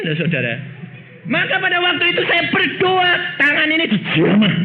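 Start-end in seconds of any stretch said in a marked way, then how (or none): 1.2-4.5 high, shouted testimony; preaching at full force. Acted. Preacher testifies to the congregation, shouting high. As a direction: cry out the moment of the touch.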